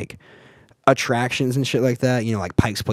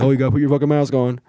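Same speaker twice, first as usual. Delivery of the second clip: mocking mimicry; 3 semitones higher, 5 dB louder, faster than usual. Mocking bathroom mask rule.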